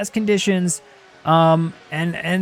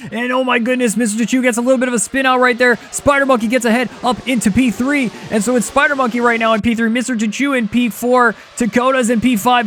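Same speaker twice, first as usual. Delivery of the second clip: higher-pitched, running race commentary; excited play-by-play; 5 semitones higher than usual. Real, not acted. Commentator tells viewers of a spin out, excited play-by-play. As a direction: call the spin and position changes with urgency.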